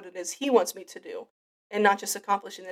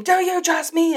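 high, loud shouted question; mock scream; 9 semitones higher, 9 dB louder than usual. God shaking the speaker awake, loud mock scream. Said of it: "conk me over the head and scream".

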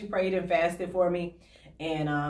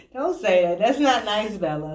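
ordinary talk, nothing marked

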